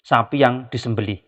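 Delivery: ordinary talk, nothing marked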